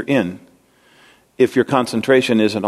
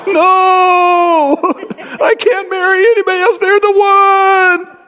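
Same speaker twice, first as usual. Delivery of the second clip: high, drawn-out mock wail of heartbreak; overacted lovelorn howl; hammed-up despairing wail; 5 dB louder, slower than usual. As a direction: cry it out loud and long, melodramatically. Voices despairing single person, shouting.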